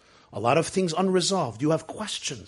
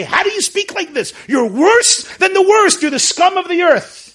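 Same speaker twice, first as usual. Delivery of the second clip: high, loud mock berating; imitating a harsh accuser who shames the doubter; scolding attack; 11 dB louder than usual. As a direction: tear into the doubter, higher and louder. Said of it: "to look at this person and say".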